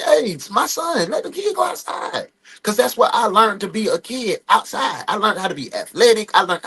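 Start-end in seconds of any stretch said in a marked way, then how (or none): 0.0-2.3 2 higher pleading mock call; 3 voice himself begging her to let the kid out, pitched up; 1 imitated himself. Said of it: "I be like".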